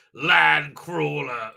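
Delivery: slow, low creature impression; mock creature reply. Creature answering back in a retold film scene, slow low mock reply. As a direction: drawl it low as a mock monster.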